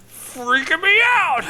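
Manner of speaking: loud, very high, drawn-out squeal; high falsetto wail, stretched slow; mock freak-out. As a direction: squeal it out in hammed-up panic.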